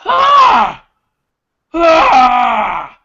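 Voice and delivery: very high, very loud raw scream of grief; anguished scream. Acted. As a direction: tear it out of the chest in pure rage and loss. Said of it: "he would shout".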